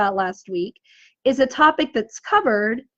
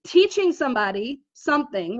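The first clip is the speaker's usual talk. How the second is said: normal talking voice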